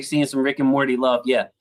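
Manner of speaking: normal talking voice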